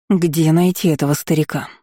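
ordinary delivery

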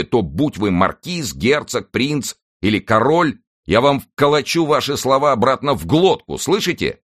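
heated, high-pitched warning; angry threat. A narrator's reading. D'Artagnan threatening the leader who insulted him, angry. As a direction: build from indignant warning to a high, forceful threat.

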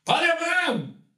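high, drawn-out acted call. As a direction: call up to the boss, high and stretched. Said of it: "él va a hablar con el boss". (llamado agudo y alargado)

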